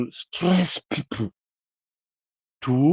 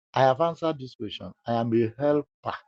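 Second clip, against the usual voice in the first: ordinary talk, nothing marked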